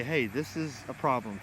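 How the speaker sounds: usual speaking voice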